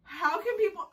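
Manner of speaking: high, tearful complaint; pained whine. Real, not acted. Vlogger wondering how anyone endures waxing, pained whine. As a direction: whine in disbelief through the pain. Hurting after the wax rip.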